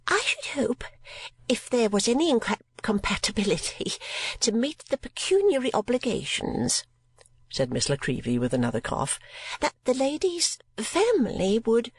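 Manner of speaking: high, affected spinster voice; mincing lady voice. A narrator's reading. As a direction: play a fussy miniature painter. Miss La Creevy speaks.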